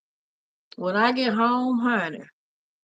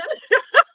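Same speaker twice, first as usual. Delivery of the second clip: much higher, loud burst of laughter; shrieking laugh; 7 dB louder than usual. Real, not acted. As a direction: crack up loudly. Laughs at Melvin joining.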